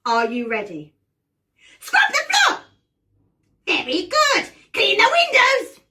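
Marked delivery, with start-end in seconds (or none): 1.8-5.8 high, loud shrieked orders; bossy witch. Pretend. Witch orders the players to do chores, shrieking. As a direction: boss them about in a piercing witch voice. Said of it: "I'm going to order you around".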